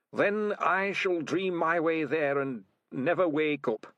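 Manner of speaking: put-on high, slow, fading old rat voice; a dying rat drifting off; frail old rat.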